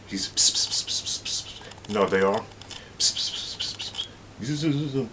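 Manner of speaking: hissed pss pss whispering sounds; gossip whisper noises. Retold or imitated. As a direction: mimic women whispering.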